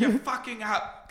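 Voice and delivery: high, drawn-out mock scolding; Gordon Ramsay. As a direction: scold slowly, without raising the volume. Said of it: "having Gordon Ramsay in your face".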